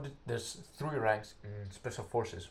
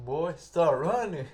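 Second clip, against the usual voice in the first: loud, higher barked order; sergeant's shout; 6 semitones higher, 9 dB louder, slower than usual. Retold or imitated. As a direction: yell the order at the recruit. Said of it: "the sergeant was saying".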